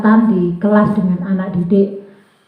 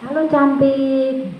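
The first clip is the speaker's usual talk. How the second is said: high, drawn-out sweet-talking greeting; Bu Guru menyapa; 4 semitones higher, much slower than usual. Retold or imitated. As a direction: imitate a teacher coaxing a little pupil. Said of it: "Itu Bu Guru tanya". (sapaan guru bernada tinggi, pelan)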